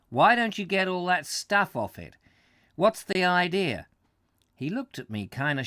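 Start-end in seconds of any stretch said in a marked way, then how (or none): 0.1-3.9 put-on light New England American accent, lively. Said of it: "the American talking".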